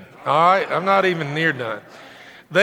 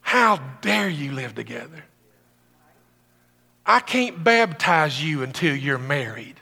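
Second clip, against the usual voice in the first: mock judgmental pastor; 3 semitones lower than usual. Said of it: "if I was spiritually disconnected".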